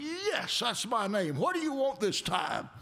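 mock annoyed holler. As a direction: snap back at a scam caller. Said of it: "hollered at him".

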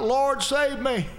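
high, slow cry for help; man slipping off his roof hollers a slow, high plea to the Lord; mock holler. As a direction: stretch out a hollered prayer while sliding off a roof. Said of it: "he hollers out".

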